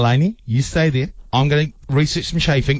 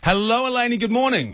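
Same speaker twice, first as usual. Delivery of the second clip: singsong greeting; 11 semitones higher than usual. Real, not acted. Host welcoming the event organiser, high singsong greeting. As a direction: sing out the greeting high and light.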